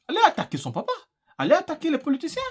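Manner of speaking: indignant taunt. Acted. Mock taunt to the regime.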